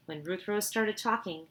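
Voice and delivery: ordinary delivery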